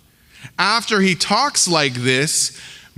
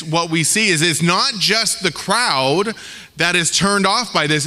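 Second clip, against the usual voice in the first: ordinary delivery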